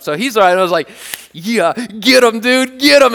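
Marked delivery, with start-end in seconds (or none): 1.3-3.1 drawn-out egging on; slow, rising cheer to rile up a dog; hyping up. Retold or imitated. Owner eggs on his barking dog, hyping him up. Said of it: "I'm gonna hype my man up".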